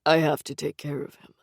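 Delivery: low, quiet, hollow dream voice. A narrator's reading. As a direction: speak low and distant. Dream figure speaks.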